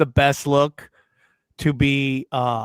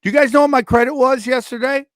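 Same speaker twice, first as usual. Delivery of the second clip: outraged; 8 semitones higher, 6 dB louder than usual. Real, not acted. Outraged about credit drop.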